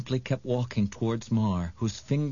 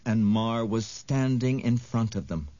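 ordinary delivery

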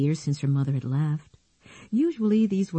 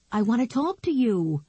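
insistent; 7 semitones higher than usual. Acted. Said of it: "his voice insisting that she hurry".

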